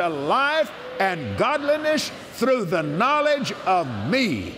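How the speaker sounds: solemn preacher's proclamation; preaching scripture. Acted. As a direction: declare it as the voice of God himself, slowly.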